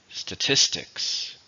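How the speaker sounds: "Statistics" slowly, with the stress on TIS; slow teacher's model of "Statistics", hitting TIS; stress on second syllable. Shown as a demonstration. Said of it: "stresses on the second syllable".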